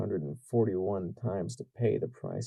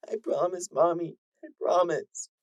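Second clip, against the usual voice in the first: tearful filly; 9 semitones higher than usual. Acted. Filly promising mother.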